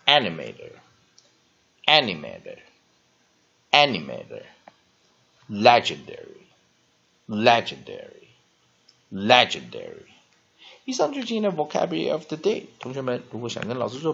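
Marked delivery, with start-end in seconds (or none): 0.1-2.6 "animator" said firmly, louder, falling each time; teacher repeating "animator" for the class; model repetition. Shown as a demonstration. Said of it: "One more time". 3.7-10.1 "animator" and "legendary" drawn out very slowly and loudly; teacher's final slow review of the words; slow drill. Shown as a demonstration. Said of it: "One more time".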